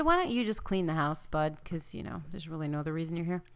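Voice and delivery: ordinary talk, nothing marked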